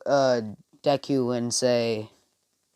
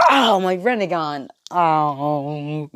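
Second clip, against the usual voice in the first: hammed-up howl of pain; acted pained cry; 2 semitones higher, 4 dB louder, much slower than usual. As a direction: cry out as if hurt, drawing out the oh. Acted pain from hitting own eye.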